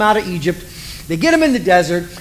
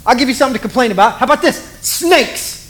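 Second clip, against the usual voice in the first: mock-angry God; 5 semitones higher than usual. God threatening complaining Israel, fast high mock anger. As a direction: rattle it off high like a fed-up parent. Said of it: "God has one of those moments".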